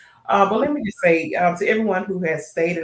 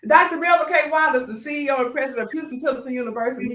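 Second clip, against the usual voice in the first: excited phone call; 8 semitones higher, 5 dB louder than usual. Retold or imitated. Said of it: "I'm finna call my grandfather and say".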